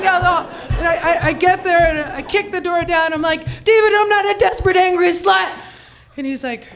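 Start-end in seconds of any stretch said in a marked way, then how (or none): 3.6-5.5 fast mock shout. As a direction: fire it out all at once, higher. Bursting into David's office.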